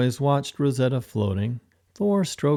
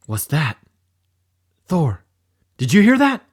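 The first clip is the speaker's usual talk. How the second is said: startled call; 4 semitones higher, 6 dB louder than usual. Acted. Startled by a sound.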